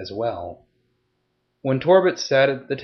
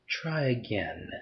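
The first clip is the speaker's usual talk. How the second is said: quiet menace; 9 dB quieter, slower than usual. Acted. She leans closer, menacing Torbit.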